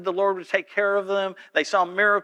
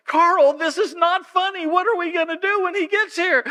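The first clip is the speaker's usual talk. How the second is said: panicked coworker; 12 semitones higher, 4 dB louder than usual. Retold or imitated. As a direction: plead in alarm with Carl. Panicked staff quote.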